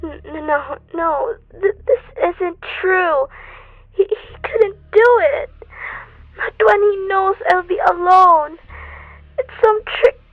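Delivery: sobbing disbelief. Acted. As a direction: break down crying, refusing to believe it. Wife reads telegram, breaks down.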